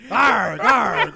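mock pirate grunt, half-joking; pirate growl. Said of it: "why you sound like a pirate?".

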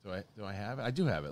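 ordinary talk

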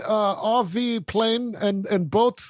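put-on slow, high, halting foreign accent; accented verdict, word by word; Pope Francis. The Pope condemning boat culture, halting foreign accent. Playing the Pope's pronouncements.

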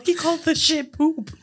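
high, slow put-on goofy voice; silly movie impression. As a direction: imitate a childish film character. Quoting a silly movie character.

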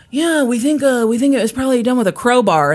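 imitating investigators. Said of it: "I'm guessing that this was like".

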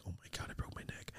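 barely-there whispered panic; frightened whisper. Retold or imitated. Kid who thinks his neck broke, acted frightened whisper. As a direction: whisper it as if afraid to move.

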